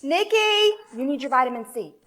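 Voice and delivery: calling mom. Acted. Nineties mom calling daughter.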